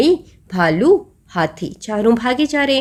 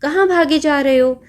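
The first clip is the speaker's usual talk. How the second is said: bear calling out; 5 semitones higher than usual. Acted. Bear's question retold.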